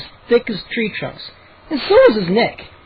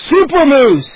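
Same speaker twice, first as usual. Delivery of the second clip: loud, high triumphant yell; 8 semitones higher, 6 dB louder than usual. A narrator's reading. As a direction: yell it like a victory cry. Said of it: "he yelled".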